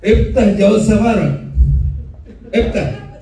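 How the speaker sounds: loud gruff command. Retold or imitated. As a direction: bark it like an angry officer. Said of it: "intonasi bicaranya keras ... seperti marah". (bentakan kasar petugas)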